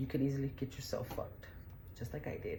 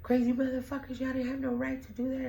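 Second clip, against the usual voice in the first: much higher, a mild mock yell; 10 semitones higher than usual. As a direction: yell it from the jail cell. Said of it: "he's, like, yelling".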